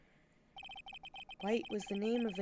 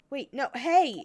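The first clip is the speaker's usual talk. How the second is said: loud startled call, higher; 5 semitones higher, 11 dB louder, slower than usual. Real, not acted. Calling after the bellboy who walks away, loud. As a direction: shout after the leaving bellboy.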